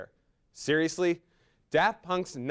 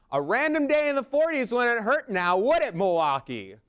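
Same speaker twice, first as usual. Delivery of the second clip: loud sarcastic jab; 9 semitones higher, 6 dB louder than usual. Pretend. Sarcastic shout at cold city.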